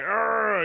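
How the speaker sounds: loud, high drawn-out "arr"; imitated roar of effort; mock straining cry. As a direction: let out a playful strained yell. Mimics straining growl while retelling.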